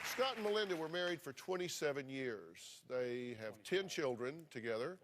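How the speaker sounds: ordinary talk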